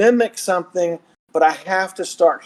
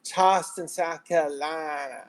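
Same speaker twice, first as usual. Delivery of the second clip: Charleston Southern accent; 8 dB quieter than usual. Shown as a demonstration. Showing friends the Southern accent that comes easier, Charleston accent. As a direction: slip into a soft Charleston accent. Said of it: "easier for me to go into a Georgia".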